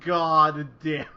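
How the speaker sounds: loud, slow, fed-up groan of a curse; exasperated outburst. Retold or imitated. Frustrated viewer reacting to a bad pun, loud exasperated curse. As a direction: let it out as the pun lands. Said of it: "I was just like".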